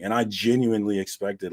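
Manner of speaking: ordinary talk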